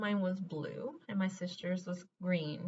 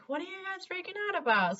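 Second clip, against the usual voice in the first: much higher, careless imitation of the uncle; dismissive uncle; 11 semitones higher than usual. Uncle brushes off the family's panic, mocking higher voice. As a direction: play the uncle brushing off their worry. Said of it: "he was like".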